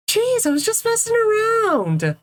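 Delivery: high put-on voice, protesting innocence; defensive protest. Spring Bonnie's defensive reply, acted.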